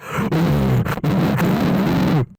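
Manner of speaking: loud mouth-on-microphone gasp; gasp into the mic. Shown as a demonstration. Showing how close the Zion actor gets, loud gasping blast. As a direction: gasp hugely, right up against the mic. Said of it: "eating the fucking microphone".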